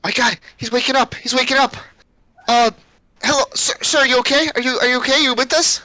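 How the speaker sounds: put-on high, hesitant child checking on someone; little girl. Caged girl to the waking captive, high child's voice. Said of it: "the girl looks at you, she goes".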